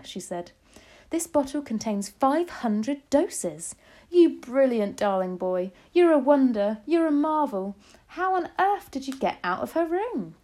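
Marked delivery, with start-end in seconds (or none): 1.1-10.3 high, loud, proud gushing; amazed delight. A narrator's reading. Grandmother praising the brilliant boy, thrilled. Grandmother marvels at child's feat.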